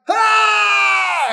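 high, drawn-out mock scream; acted terrified scream. Preacher woken at night by an angel, mock freak-out scream. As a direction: scream as if startled awake. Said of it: "I would be freaking out".

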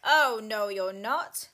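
loud roared refusal; booming storybook troll. A narrator's reading. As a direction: roar it as the troll, cutting the goat off. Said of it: "roared the troll".

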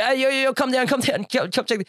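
high-pitched, urgent call-over; calling to a mate. Retold or imitated. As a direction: imitate someone excitedly summoning a workmate. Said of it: "he calls to his mate at the back".

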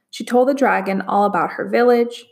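ordinary delivery

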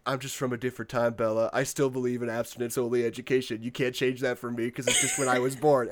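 low, soft and quick mock Edward. Podcaster imitating Edward explaining himself to Bella, low and soft. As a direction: mumble it fast and low like a stiff old-fashioned vampire. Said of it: "but then Edward is like".

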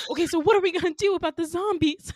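high, loud, hurried cry; mock panic. Asking classmates about the zombies in loud mock panic. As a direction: play up the alarm, pitched high and fast.

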